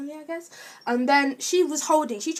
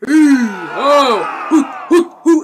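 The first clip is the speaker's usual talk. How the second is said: straining grunts; 3 semitones higher, 12 dB louder, slower than usual. Retold or imitated. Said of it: "they were like".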